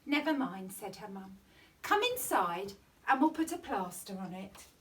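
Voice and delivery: ordinary delivery, nothing marked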